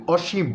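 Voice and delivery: অসীম with a short stop on the final ম; pronunciation demo. Shown as a demonstration. Teacher showing how অসীম ends on a clipped ম. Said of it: "ময়ের মধ্যে একটা ছোট্ট ধাক্কা".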